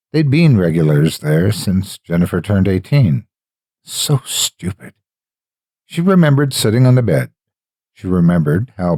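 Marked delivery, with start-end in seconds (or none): 3.8-4.9 slow, breathy self-reproach under the breath; bitter whisper. A narrator's reading. Jennifer scolding herself over Brian, slow whisper. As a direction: hiss it at yourself, slowly.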